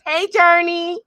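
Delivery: light up at a friend arriving; excited greeting. Real, not acted.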